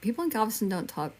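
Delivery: usual speaking voice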